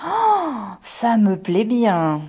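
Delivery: high, loud, drawn-out gasp of joy; delighted exclamation. A narrator's reading. Daughter thrilled by her Irish roots, exclaiming. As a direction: squeal it slowly with little-girl delight. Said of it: "s'exclama Megumi".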